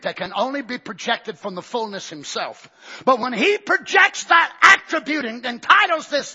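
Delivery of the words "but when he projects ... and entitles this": loud rising preacher's cry; full-force pulpit shout; preaching shout. Real, not acted. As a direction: lift it up and shout it to the church.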